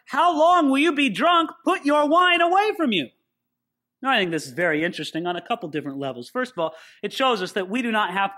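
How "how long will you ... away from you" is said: accusing priest. Acted. Eli accuses Hannah of drunkenness.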